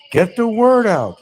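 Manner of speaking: urging. Real, not acted. Urging, repeated.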